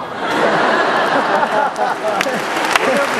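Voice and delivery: loud high laughter at his own joke; real laugh. Real, not acted. Telling the congregation about mama, laughing aloud. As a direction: burst out laughing.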